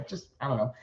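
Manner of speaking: giggle, then push out Just; giggle laugh. Real, not acted. To viewers, giggling at himself mid-sign-off. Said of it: "I don't know why I giggle laugh there".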